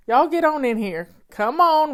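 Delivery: loud welcoming call. Real, not acted. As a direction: call them in, loud and bright. Calling everyone in.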